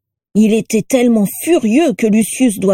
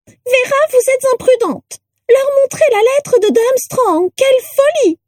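very high, shrill scolding; rattle-voiced portrait. A narrator's reading. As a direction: scold in a piercing, reedy old portrait voice. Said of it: "une voix de crécelle".

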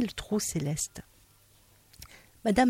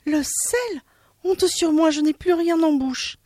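high ashamed voice; 10 semitones higher than usual. Acted. Madame Vazedoux confessing to Monsieur Dard, high ashamed cry. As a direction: admit it with mock shame. She confesses salt gone.

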